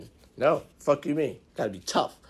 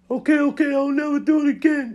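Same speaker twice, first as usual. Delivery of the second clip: high-pitched mock promise, much higher than usual; placating promise; 12 semitones higher, 4 dB louder than usual. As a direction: placate a partner in a squeaky, high voice. Mimicking men's empty promise to partner.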